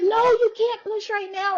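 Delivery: high-pitched alarmed order, imitated; urgent high retold warning; panicking nurse. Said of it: "they're panicking".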